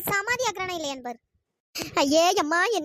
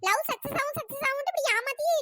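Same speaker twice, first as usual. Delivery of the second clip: higher, angry complaint; raised voice; 5 semitones higher than usual. Acted. Girl angrily accuses the older woman of cheating her with the ring. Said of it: "voice எகுறுது".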